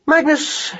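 high hare voice, mildly weary; patient weariness. Acted. Hare addresses slow mouse, mildly patient and weary. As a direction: say the name with strained patience. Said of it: "in a tone of patient weariness".

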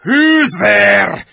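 shouted wolf growl, pitched high; loud snarling sentry wolf. A narrator's reading. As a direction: bellow the challenge in a beastly growl. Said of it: "said in a growling voice".

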